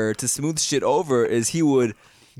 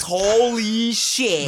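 1 amazed; 6 semitones higher, much slower than usual. Real, not acted. Recognizes Sixth Sense kid.

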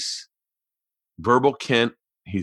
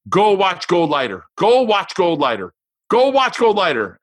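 higher, louder, pushy little daughter insisting; insistent kid; 5 semitones higher, 4 dB louder than usual. Retold or imitated. Daughter insisting to dad about the movie, loud and high. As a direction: repeat it like a stubborn child sure she's right. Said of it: "And she just goes".

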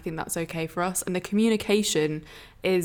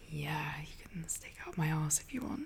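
breathy submissive whisper; 5 semitones lower, 14 dB quieter than usual. Retold or imitated. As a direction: whisper it coyly, half laughing. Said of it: "my full little submissive role".

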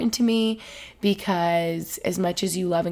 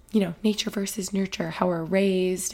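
usual speaking voice

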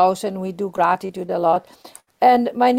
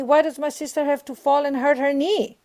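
usual speaking voice

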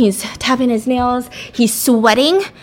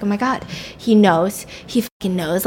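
usual speaking voice

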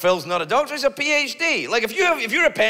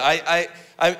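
ordinary delivery

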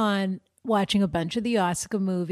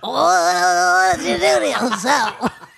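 slow, laughing Boston drawl; Boston accent; 6 dB louder, much slower than usual. Retold or imitated. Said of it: "such a distinguished accent".

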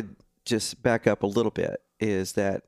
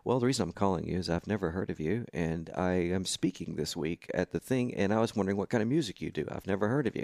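lightly imitated deep caller, softer; James's deep voice; 3 semitones lower, 7 dB quieter than usual. Speaker phoning an unknown youth musician, lightly voiced deep tone. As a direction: play a deep-voiced stranger explaining his call, quietly. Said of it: "this guy, really deep voice".

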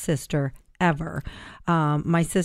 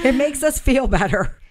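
laughing; 6 semitones higher, 4 dB louder than usual. Real, not acted. Laughing after joke.

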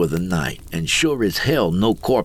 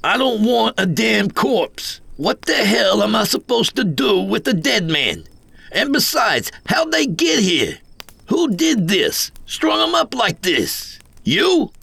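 very high, agitated outburst; outraged protest; 12 semitones higher than usual. A narrator's reading. Greenhorn refuses a corpse and accuses Coyote, high outraged roar. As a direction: rant in panicky, high-pitched indignation. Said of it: "I roared, jumping to my feet".

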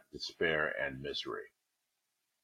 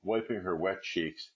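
usual speaking voice

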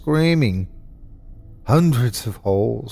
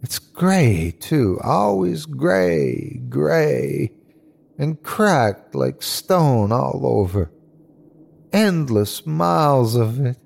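frightened whine with drawn-out words; whiny, small, frightened; 3 semitones higher than usual. Acted. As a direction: sound small and overwhelmed by fear. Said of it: "It was whiny and small and frightened".

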